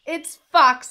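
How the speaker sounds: high, loud announcement; excited mouse. A narrator's reading. Mouse spots fox ahead, high and excited. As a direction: pipe up loudly as the mouse.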